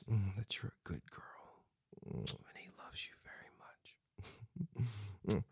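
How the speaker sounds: unvoiced whisper, close to the ear; right in your ear. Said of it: "just whisper right in your ear".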